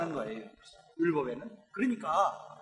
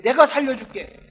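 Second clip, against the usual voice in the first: 내가 살려줄게 said higher and louder, 내가 hit hard; emphatic reassurance; 5 semitones higher, 7 dB louder than usual. Shown as a demonstration. Preacher showing how Jesus might have comforted the father, emphatic. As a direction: promise it firmly, building up. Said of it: "이렇게 말했으면 좋겠는데".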